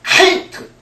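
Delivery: a breathy 케이트 with a weak front k; 케이트 as the wrong gentle version; wrong light sound. Shown as a demonstration. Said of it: "잘못 들으시고".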